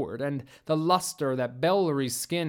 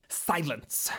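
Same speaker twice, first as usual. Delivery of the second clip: softer, scratchy and half-whispered; 5 dB quieter than usual. Acted. Old alien leader silencing the human, scratchy whisper. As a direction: rasp the command out under the breath. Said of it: "in a scratchy, whispery voice".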